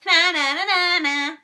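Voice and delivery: high, loud, taunting shriek. Acted. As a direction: jeer like a playground bully. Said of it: "shrieked Margaret".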